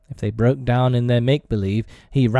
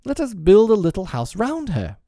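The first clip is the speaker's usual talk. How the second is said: put-on high, eager boy announcing a plan; excited cry; 10 semitones higher, 4 dB louder than usual. A narrator's reading. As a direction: cry it out with a bright idea. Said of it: "cried Peter".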